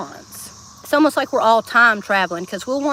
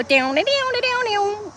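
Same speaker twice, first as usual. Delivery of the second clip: higher sung guitar riff; vocal guitar solo; 8 semitones higher, slower than usual. Pretend. Imitating the guitar solo for the chat. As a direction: mimic the guitar solo with your voice. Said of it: "Then they got an awesome solo".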